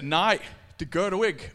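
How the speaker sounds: loud, firm no; Peter refusing. Acted. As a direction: refuse it flatly and loudly.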